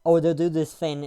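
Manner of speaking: ordinary delivery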